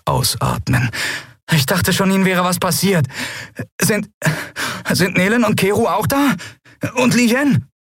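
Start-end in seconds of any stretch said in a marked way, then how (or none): 1.5-7.7 put-on high, anxious young voice; nervous. Boy asking captain after the others, high nervous voice. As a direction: ask haltingly, worried and relieved. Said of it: "eine nervöse Stimme".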